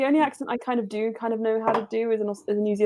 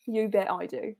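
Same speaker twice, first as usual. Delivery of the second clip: normal talking voice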